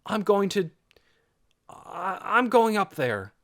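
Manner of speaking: stumble, then commit to it; hesitant resolve. A narrator's reading. Nervous boy resolves to step forward.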